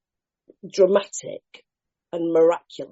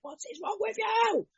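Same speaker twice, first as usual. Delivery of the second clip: high-pitched mock exasperation; soft, high imitation of an exasperated employer; 8 dB quieter than usual. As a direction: squeal it like a fed-up boss. Acting out exasperated employers' question.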